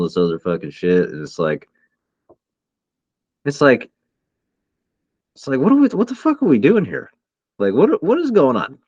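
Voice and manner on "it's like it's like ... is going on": exasperated outburst. Real, not acted. Friend to friend, venting at the state of the world, exasperated. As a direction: voice climbs high in disbelief.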